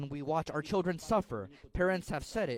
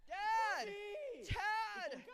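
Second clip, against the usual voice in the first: put-on much higher, a child's cry of "Dad!"; boy calling out; 7 dB quieter than usual. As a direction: call to your father as a frightened boy. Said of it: "The boy's shouts".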